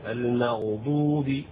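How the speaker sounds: المغضوب slowly, with the ghayn clearly silent; distinct ghayn sukun. Shown as a demonstration. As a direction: show the sakin ghayn in المغضوب. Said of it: "فيجب إظهار السكون في الغين".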